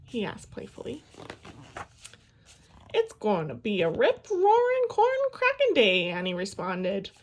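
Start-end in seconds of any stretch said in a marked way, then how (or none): 2.9-6.1 put-on high, loud whooping girl; excited. A narrator's reading. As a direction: shout it out like a cowgirl. Excited girl answers Dad.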